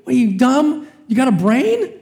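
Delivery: yelling at the TV in mock outrage; exasperated. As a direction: fire the insult at the TV in disbelief. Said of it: "I'm going".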